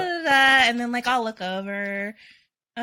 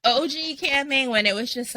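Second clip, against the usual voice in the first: normal talking voice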